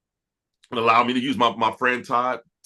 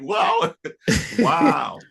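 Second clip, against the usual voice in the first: amazed; 5 semitones higher than usual. Retold or imitated. Retelling reaction to praise, laughing.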